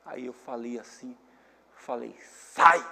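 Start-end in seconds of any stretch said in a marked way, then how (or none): 2.2-2.8 loud barked command to get out; father ordering his agitated son out, in a loud command; sharp order. Retold or imitated. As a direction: snap one loud word at him.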